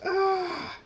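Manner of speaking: long, very high loud scream; sustained shrieked "ah"; character scream. A narrator's reading. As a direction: scream as if transformed. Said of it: "Draconis screams".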